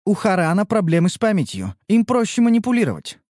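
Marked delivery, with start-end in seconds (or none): none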